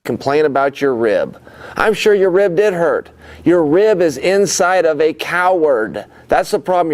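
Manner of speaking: yelling insults at full volume; angry shouting. Real, not acted. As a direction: bellow the taunt. Trash-talking rival at press conference.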